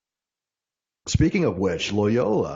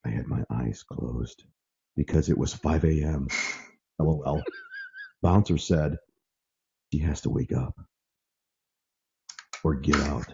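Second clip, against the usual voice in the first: sultry voice; 8 semitones lower, 3 dB quieter than usual. Retold or imitated. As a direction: purr the story out low. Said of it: "I'm gonna use a sultry voice".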